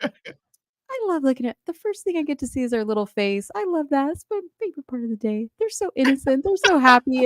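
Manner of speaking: mock-tender imitation of a friend; doting mom. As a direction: gush like a besotted mother. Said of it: "when Suni's talk about".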